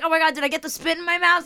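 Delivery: high, rushed panicky question; acted-out panic; panicked. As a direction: blurt it out in disgusted alarm. Panicking after being spat on.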